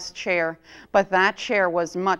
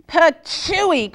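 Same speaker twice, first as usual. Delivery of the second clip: loud spluttering Patooey, spitting out hot porridge; spitting cry; 8 semitones higher, 6 dB louder than usual. A narrator's reading. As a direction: spit the word out loud like a burned tongue. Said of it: "cried big old Papa Bear".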